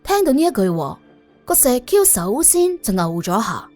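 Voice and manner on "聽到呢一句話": ordinary delivery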